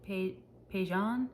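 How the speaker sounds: Pagan as pay-ZHAHN, slow, after a false start; hesitant pronunciation attempt. Shown as a demonstration. Vlogger puzzling over an author's surname, Pagan slowly as pay-ZHAHN. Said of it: "there's an accent over the second A".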